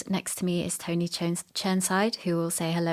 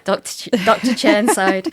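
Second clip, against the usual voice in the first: teasing title; 3 semitones higher, 8 dB louder than usual. Pretend. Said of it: "lot of teasing goes on around that".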